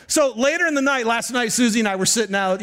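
ordinary talk, nothing marked

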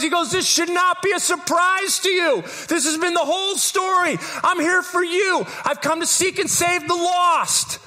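high, ringing sermon voice; preaching at full force. Acted. Preacher urging the congregation, full force. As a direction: preach it out to the room.

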